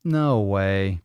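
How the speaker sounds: ordinary delivery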